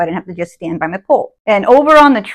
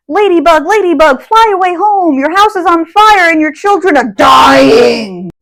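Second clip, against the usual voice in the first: reciting nursery rhyme; 8 semitones higher, 5 dB louder than usual. Retold or imitated. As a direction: recite it like the great aunt did. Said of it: "That whole".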